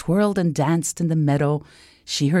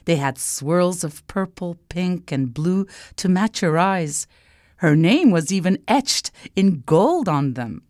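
ordinary delivery

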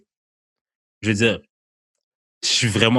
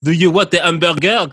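cashier's puzzled reply, higher and louder; mock English-speaking cashier; 7 semitones higher, 5 dB louder than usual. To a Quebec customer, a mock puzzled cashier, higher and louder. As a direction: play a clerk who pretends not to understand.

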